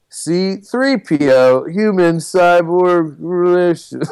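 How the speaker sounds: mock-drunk protocol droid, very slow; drunk robot. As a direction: draw it out like a sozzled robot. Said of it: "what would that drunk robot sound like".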